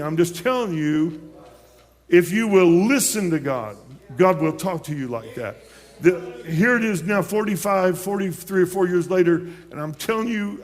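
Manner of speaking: fervent preaching. Real, not acted. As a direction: press it home to the congregation. Testimony rising to preaching.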